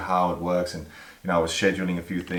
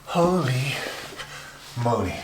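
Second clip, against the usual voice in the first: out of breath; 5 semitones higher than usual. Real, not acted. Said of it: "quite exhausted".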